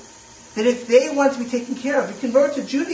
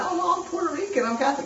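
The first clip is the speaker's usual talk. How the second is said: higher imitated Puerto Rican accent; imitating Puerto Rican accent; 10 semitones higher, 4 dB quieter, faster than usual. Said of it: "The guy has a Puerto Rican accent".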